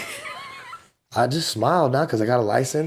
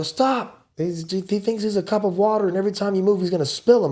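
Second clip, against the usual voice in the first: higher urgent warning voice; a lightly voiced worried orderly; mock urgent staff warning, higher; 8 semitones higher than usual. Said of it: "They go".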